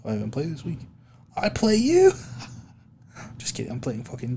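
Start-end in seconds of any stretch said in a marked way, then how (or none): 1.3-2.1 mock surprise. Teasing his league rival, mock surprise. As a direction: pretend shock, pitch leaping high. Mock shock, then just kidding.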